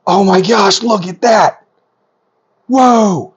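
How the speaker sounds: high-pitched amazed exclamation; thrilled, voice leaping up; geeking out. Real, not acted. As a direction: burst out in real wonder. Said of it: "I am geeking out completely".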